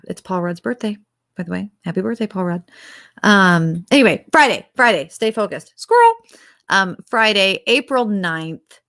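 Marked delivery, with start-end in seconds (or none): 5.8-6.1 high, loud sudden exclamation; playful yelp of distraction; mock distraction. Mock distraction while refocusing.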